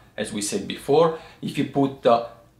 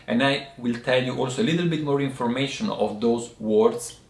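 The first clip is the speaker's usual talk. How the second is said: normal talking voice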